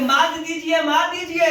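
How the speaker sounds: mildly acted urgent warning; Shalya pleading. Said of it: "बल्कि कि". (हल्का नाटकीय आग्रह)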